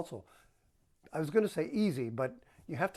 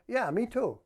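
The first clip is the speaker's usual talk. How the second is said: higher, bright sincere agreement; sincere, engaged; 6 semitones higher, 4 dB louder than usual. Shown as a demonstration. Husband answering his excited wife with real interest. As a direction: really share her excitement. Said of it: "If instead you're saying".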